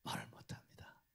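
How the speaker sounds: hushed whisper with no voice; whispering that he cannot speak. Real, not acted. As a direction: breathe it out, silenced. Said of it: "narration of silence".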